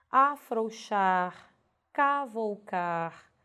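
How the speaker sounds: "Afrouxar, cavalcar" bem devagar, com OU pleno; pronúncia correta. Shown as a demonstration. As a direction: diga "Afrouxar, cavalcar" silabando, a-frou-xar, ca-vou-car. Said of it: "Se você pronunciar corretamente". ("Afrouxar, cavalcar" com ditongo OU esticado)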